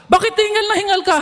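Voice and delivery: high, anxious mother's voice on the phone; worried mother. Retold or imitated. Mother asking her soldier son why he's panting, high and worried. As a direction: sound like a mom fretting over her son.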